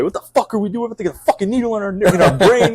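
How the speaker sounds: put-on freaked-out New York accent cursing about the needle; thick New York accent. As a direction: imitate a panicked New Yorker with a heavy New York accent. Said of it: "real thick accent".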